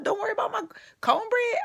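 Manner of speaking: "don't worry about my cornbread" squeaked high and scared; mock frightened squeak. Mocking her own tough line with a frightened high squeak. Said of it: "I went from hardcore".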